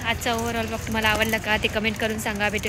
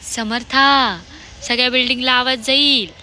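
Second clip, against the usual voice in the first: raised-voice admonishing; 6 dB louder than usual. Real, not acted. Mother telling child the whole building will hear, raised-voice admonishing. Shushing a shrieking child loudly.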